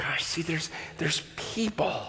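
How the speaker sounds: hushed, breathy aside to himself; soft, unvoiced awestruck murmur; awed whisper. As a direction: whisper it in awe.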